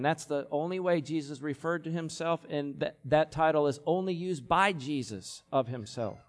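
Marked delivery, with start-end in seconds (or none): none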